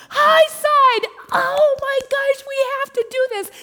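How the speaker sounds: very high, loud cry of alarm; urgent yell. Retold or imitated. Guide calls high side at the rock, urgent yelling. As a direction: yell it high, stretching the words.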